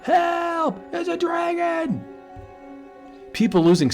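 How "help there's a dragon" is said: high, frantic cry of alarm; screaming about the dragon; terrified shout. Acted. Frightened citizen shouting that a dragon is there.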